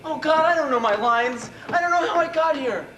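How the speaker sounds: loud, high panic; panicked outburst. Acted. Actor who forgot his lines, panicked outburst. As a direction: blurt it out in rising desperation.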